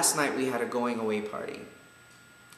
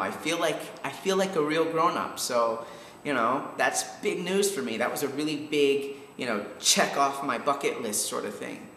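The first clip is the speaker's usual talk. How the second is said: normal talking voice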